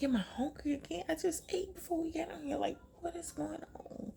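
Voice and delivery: nervous whisper; anxious self-talk. Retold or imitated. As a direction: panicky murmuring to yourself. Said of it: "I'm like".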